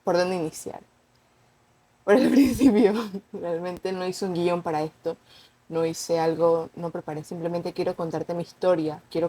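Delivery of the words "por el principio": laughing. Real, not acted. Laughs while opening her story.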